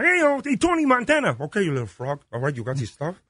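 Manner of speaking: high Kermit voice as a gangster; 높은 개구리 목소리로 갱스터 연기; Kermit as Tony Montana. Retold or imitated. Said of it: "it was a Kermit the Frog".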